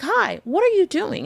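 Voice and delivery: high, bright-eyed shocked hello; startled greeting. Retold or imitated. To the seller packing up the shop, a high startled greeting. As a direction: catch her in the act, caught off guard. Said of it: "And I'm like".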